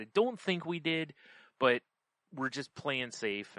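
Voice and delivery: usual speaking voice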